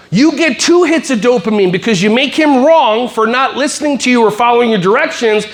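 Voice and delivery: ordinary delivery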